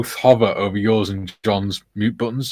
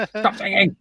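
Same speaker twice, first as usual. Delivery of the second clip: mock shout; 8 semitones higher, 4 dB quieter than usual. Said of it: "the modder shouts at him".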